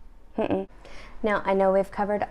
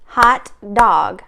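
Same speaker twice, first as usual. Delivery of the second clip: Hot dog said in two even clapped beats, louder; clapped syllables; 6 dB louder than usual. Shown as a demonstration. Teacher clapping hot dog on the rhythm chart. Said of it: "that's two claps".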